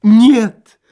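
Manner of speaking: high, loud outburst; theatrical loud wail; exclaimed protest. A narrator's reading. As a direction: cry out in dramatic refusal. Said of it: "воскликнул Лоханкин".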